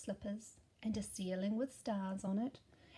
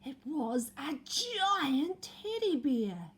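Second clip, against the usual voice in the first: slow, loud big reveal; dramatic reveal; 4 semitones higher, 7 dB louder, much slower than usual. A narrator's reading. Narrator revealing the giant bear to children, slow and loud. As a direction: draw it out for the surprise.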